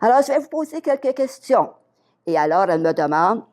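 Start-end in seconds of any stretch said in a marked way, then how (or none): 0.0-1.7 high-pitched imitated interviewer on a call; Nina. Nina announces questions to Madame Lheureux, imitated high caller voice. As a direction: quote the caller in a raised voice. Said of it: "Ici Nina de Rêve de Vie Canada".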